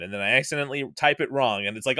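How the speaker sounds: normal talking voice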